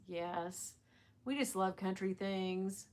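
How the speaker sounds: ordinary delivery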